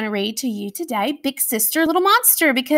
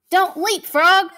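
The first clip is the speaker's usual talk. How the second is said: playful shout; 8 semitones higher, 5 dB louder than usual. Acted.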